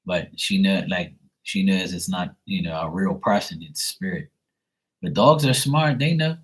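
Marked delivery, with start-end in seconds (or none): none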